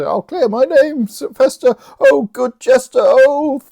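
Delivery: high, loud grateful wailing; hammed-up high pleading; mock desperate Malvolio. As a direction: wail it, overcome with put-on relief.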